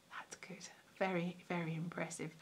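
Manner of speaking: dry, breathy whispered aside; sarcastic whisper. Real, not acted. Whispering a dry joke to viewers about the photo. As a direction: murmur it as a wry private joke.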